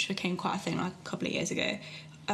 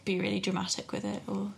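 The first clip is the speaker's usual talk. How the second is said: usual speaking voice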